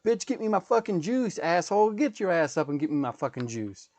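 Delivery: mock demanding rant, high at the start; imitating Kevin Hart. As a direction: bark it like an impatient kid. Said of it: "Kevin Hart translated into".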